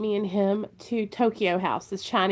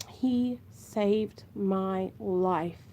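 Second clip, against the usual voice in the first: very slow and softer, welling up as she repeats it; moved repeat; 4 dB quieter, much slower than usual. Real, not acted. Mom telling viewers her son saved her, slow and hushed. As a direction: let it sink in, slow and hushed.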